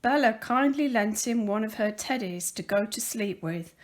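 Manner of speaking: usual speaking voice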